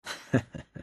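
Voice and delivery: a brief breathy chuckle; soft chuckle. Acted. Raider lieutenant chuckling at the boss's plan, soft amused chuckle. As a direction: chuckle quietly to yourself, amused.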